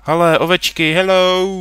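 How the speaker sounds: high, loud delighted greeting; excited shout. Real, not acted. Gamer greeting sheep on screen, high excited shout. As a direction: yell hello to the sheep.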